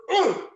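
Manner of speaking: high, loud straining grunt; squeeze grunt. Acted. Bear squeezing the bird, a loud straining grunt. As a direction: grunt as you squeeze hard.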